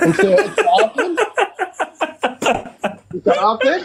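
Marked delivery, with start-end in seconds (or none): 0.1-1.2 high, slow, laughing disbelief; mocking laughing question. Real, not acted. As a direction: laugh it out at the opponent, slowly. Mocking the opponent in debate. (سؤال ساخر بضحكة)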